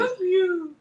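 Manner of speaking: high, cooing pet voice; talking to a dog. Pretend. Owner prompting her dog to talk, high pet voice.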